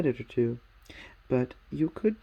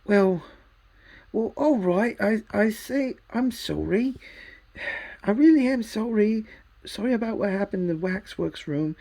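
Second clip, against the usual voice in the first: put-on higher, louder, pleading boy; flustered apology; 7 semitones higher, 6 dB louder than usual. A narrator's reading. Diggory apologising to Polly, louder pleading boy. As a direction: stammer out a sorry and beg.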